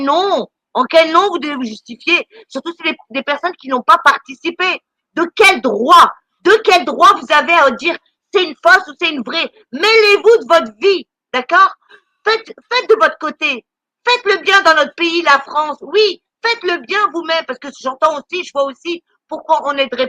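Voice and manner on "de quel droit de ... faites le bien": indignée, voix montée et plus forte; prends-les à partie, en martelant tes questions; vraie colère. Real, not acted. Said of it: "je suis en colère après vous". (coup de gueule indigné)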